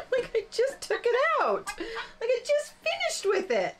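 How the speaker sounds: very high squeal through laughter; real laughing disbelief, pitch shooting way up; helpless laughter. Real, not acted. Crafter cracking up over misplacing her card, high laughing squeal.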